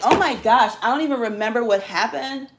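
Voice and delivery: a touch of high-pitched mock shock; mock morning-after regret. Mimicking someone waking up after drinking, mock shocked. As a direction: lightly play waking up aghast. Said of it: "Then wake up like".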